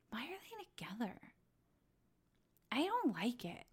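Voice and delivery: hushed baffled aside; whisper. Real, not acted. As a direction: whisper it in disbelief. Baffled aside about a couple.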